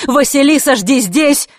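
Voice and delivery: high, loud quick command; urgent shout. A narrator's reading. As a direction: call out on the move. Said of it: "Выкрикнув на ходу".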